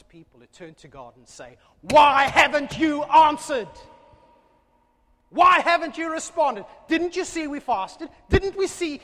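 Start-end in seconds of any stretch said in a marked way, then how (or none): 1.8-9.0 proud demanding shout. Retold or imitated. Israel shouting at God for ignoring their fast, loud and indignant. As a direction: hurl the complaint at God in outrage. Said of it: "They asked proudly".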